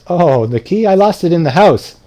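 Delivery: lightly acted simpleton's obvious answer; matter-of-fact realization. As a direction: answer brightly, as if it were obvious. Nasruddin's carefree answer in the parable.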